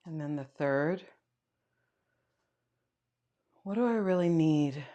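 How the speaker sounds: quiet meditation guide, long pauses between prompts; guided meditation. Acted. Yoga teacher guiding students to notice their breath, guided meditation. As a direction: lead them softly inward, leaving space.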